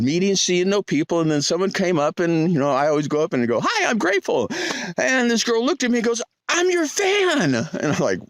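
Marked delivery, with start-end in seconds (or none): 3.6-4.5 high-pitched, cheery self-introduction; acted greeting. Hiker greeting people on the trail, high acted hello. 6.5-7.5 high, drawn-out fan gush; imitated excited fan. Girl meeting the hiker on the trail, imitated excitement.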